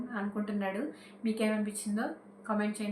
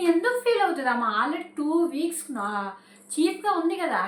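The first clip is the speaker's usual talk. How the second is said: incredulous outburst; 5 semitones higher, 5 dB louder than usual. Real, not acted. Indignant rhetorical question to viewers.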